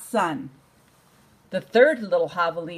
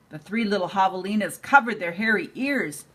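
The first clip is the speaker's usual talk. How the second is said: ordinary delivery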